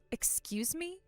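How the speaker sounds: a breathless, whispered "excuse me?"; indignant whisper. Acted. Kathy stunned by the insult to her husband, whispered indignation. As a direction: hiss it in disbelief.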